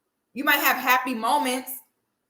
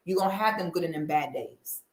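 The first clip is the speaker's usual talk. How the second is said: ordinary talk, nothing marked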